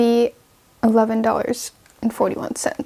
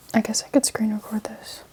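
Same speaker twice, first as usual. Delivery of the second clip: whispering; 7 dB quieter than usual. Real, not acted. Muttering to herself while typing.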